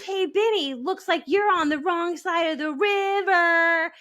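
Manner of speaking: high, slow sing-song quote; movie-line inflection. Shown as a demonstration. Correcting a friend, showing the right movie-line lilt. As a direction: lilt it up high and drawn out like the film. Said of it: "You have to do the inflection like that".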